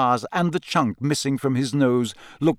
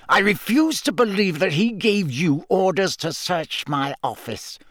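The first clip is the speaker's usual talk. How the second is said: higher, tight-jawed indignation; through clenched teeth; 6 semitones higher than usual. A narrator's reading. Snape refusing to believe Moody had orders, through clenched teeth. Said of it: "said Snape through clenched teeth".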